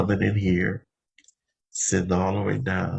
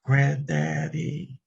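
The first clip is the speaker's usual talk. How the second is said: echoing grandson; 8 semitones higher, much slower than usual. Retold or imitated. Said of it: "a whole lot of other names".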